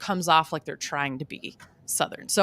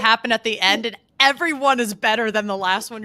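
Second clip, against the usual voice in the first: ordinary talk, nothing marked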